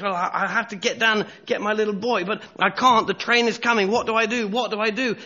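high, loud agonised dilemma; panicked father. Acted. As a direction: act a desperate dad thinking aloud. Father panics over an impossible choice.

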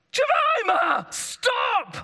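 high, loud parental yell of warning; full-voiced, high-pitched warning shout; urgent shout. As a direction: yell to stop a child in danger. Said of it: "I shouted".

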